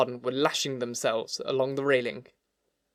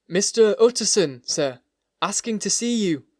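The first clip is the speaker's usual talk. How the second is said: raised, high announcing call; 6 semitones higher, 6 dB louder than usual. A narrator's reading. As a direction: call through a closed door to the master. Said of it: "he called".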